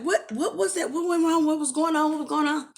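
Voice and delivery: high, imitated flurry of questions; shocked questioning. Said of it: "I'm more shocked. So they're like".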